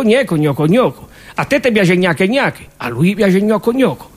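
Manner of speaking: ordinary talk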